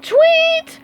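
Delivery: very high, loud imitated whistle blast; whistle tweet. Squidward blowing his whistle at the team, shrill tweet. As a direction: make a sharp whistle sound. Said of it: "blew his whistle".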